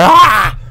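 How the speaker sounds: sudden high, loud yelp; mock jump-scare shriek; startled scream. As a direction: scream as if something jumped out. Jump scare joke while playing game.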